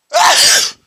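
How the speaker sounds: loud explosive sneeze. Real, not acted. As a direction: let out a big real sneeze. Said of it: "just sneezed".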